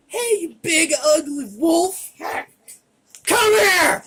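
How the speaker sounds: loud, high mocking yell at a monster; taunting shout. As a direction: call the wolf out, full volume. Player taunts wolf in character.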